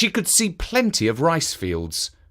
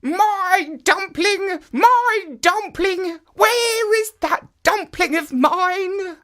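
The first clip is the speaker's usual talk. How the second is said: put-on high, slow, wailing old woman's voice; old woman crying out; slower than usual. A narrator's reading. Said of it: "still crying out".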